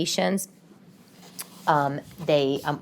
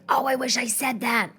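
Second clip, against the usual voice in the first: higher-pitched, mock-rueful afterthought; acted regret; 6 semitones higher, 3 dB quieter than usual. Replaying an altercation to herself, mock regret. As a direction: play kicking yourself afterwards. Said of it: "you're like".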